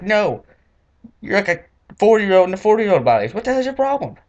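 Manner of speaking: high, loud scolding; outraged high-pitched comeback; indignant retort. Retold or imitated. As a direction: shut him down in disbelief. Retort to store owner.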